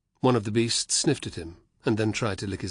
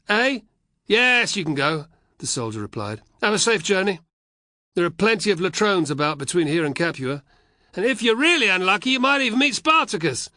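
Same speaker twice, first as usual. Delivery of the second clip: higher, louder laughing legionaries; mock the boy who gambled his horse; jeering soldiers; 10 semitones higher, 7 dB louder than usual.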